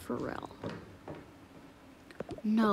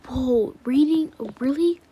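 thrilled surprise; 2 semitones higher, 11 dB louder than usual. Acted. Child thrilled to hear there is no reading, excited. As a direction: burst with delight. Kid hears no school work.

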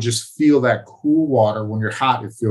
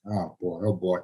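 nervous muttering; 4 semitones lower, 10 dB quieter than usual. Retold or imitated. Retold pre-fight nerves muttered.

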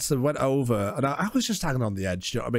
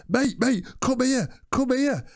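high, drawn-out mock plea; slow, raised-pitch retold plea; dazed calling out; 8 semitones higher, slower than usual. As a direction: imitate a knocked-out mate calling you over. Quotes friend calling him over, dazed.

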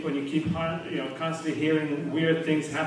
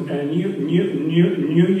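stuck repeating the first syllable; imitated stutter; 6 dB louder, slower than usual. First guy answers the barmaid where he's from, stuttering. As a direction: play a stutterer straining to get the word out. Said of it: "she sees they're stuttering".

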